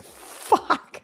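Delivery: breathy whispered swear; whispered curse. Retold or imitated. Mom realizing son was right, whispered curse. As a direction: mutter the curse under your breath in dismay. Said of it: "I was like".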